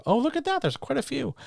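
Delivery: high, soft delighted surprise; a sudden lift of pleased wonder. Real, not acted. Host spots how many viewers are in chat, delighted surprise.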